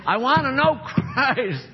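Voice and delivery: high, slow and rapt, a laugh breaking in; Paul going joyfully to the block; Paul on the way to his execution, high and slow with a laugh; voicing Paul. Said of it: "he was saying".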